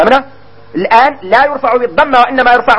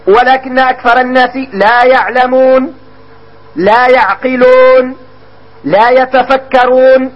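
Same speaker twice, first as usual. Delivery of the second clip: لا يعقلون لا يتفكرون drawn out slowly; slow quoted examples; slower than usual. Shown as a demonstration. Teacher quoting examples, slowly and deliberately. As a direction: recite the phrases slowly. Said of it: "نقولوا".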